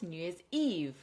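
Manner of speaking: "New Year's Eve" with the weight on Eve; last-syllable stress. Shown as a demonstration. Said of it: "Stress on the last syllable".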